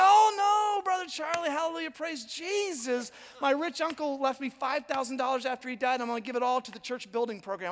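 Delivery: high, effusive church-lady gush; gushing Pentecostal lady. Retold or imitated. Sue announcing her gift to the pastor, high and effusive. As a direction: gush out the good news. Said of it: "She said".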